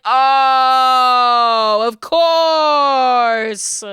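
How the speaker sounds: slow, drawn-out mock epiphany; hammed-up "aha" moment, words dragged out; dawning realization. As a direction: stretch every word as it all clicks. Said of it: "you're like".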